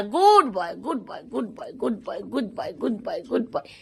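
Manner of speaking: reward him gently; soft praise. Real, not acted. Owner softly praising her pet for sitting.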